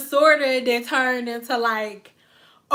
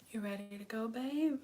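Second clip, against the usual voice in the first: soft casual ask; 3 semitones lower, 17 dB quieter than usual. Retold or imitated.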